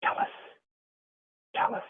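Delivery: soft ghostly "Chalice, chalice"; gentle hushed repetition of "chalice"; softly spoken. Retold or imitated. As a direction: breathe the word like a spirit at your ear. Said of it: "very softly spoken".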